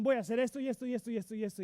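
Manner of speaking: fast, tumbling list of promises; rattled-off promises. Retold or imitated. Churchgoer promising pastor new year effort, rattled off fast. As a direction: rush through the promises like an eager churchgoer.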